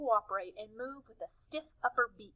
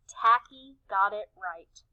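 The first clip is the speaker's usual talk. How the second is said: usual speaking voice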